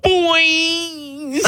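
very high, long-held cartoonish call; mock yell. Joking about calling out to [name], high mock yell. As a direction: sing out one word high and stretched, without full volume. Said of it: "And I yelled out".

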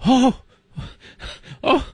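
shrieking high oh, oh; mock shocked gasp. Snobs hearing Walter works at NBC, in mock shock. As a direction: gasp in hammed-up horror.

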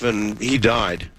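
normal talking voice

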